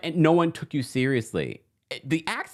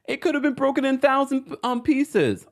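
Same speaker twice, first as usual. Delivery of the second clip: mocking quote; 12 semitones higher than usual. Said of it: "Breezy says".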